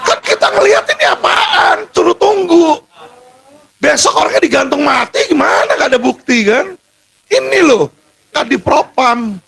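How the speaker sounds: real high-pitched cry of outrage; heartfelt, high, strained protest; anguished outcry. Real, not acted. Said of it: "Gimana saya nggak teriak hati saya".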